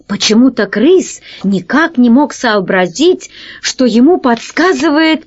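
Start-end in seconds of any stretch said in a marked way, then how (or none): none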